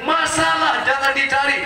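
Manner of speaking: ordinary talk, nothing marked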